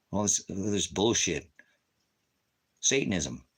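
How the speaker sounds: usual speaking voice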